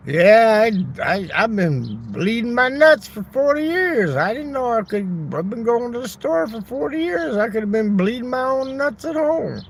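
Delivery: high, loud, astonished voice of a guy in an ad; amazed old man. Retold or imitated. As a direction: squeal it like a man who just discovered something. Imitating an amazed customer.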